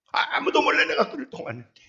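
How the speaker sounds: ordinary talk, nothing marked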